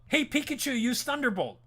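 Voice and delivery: hammed-up anime battle cry; mock trainer command. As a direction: shout the attack order like a trainer. Voicing a Pokémon trainer's command.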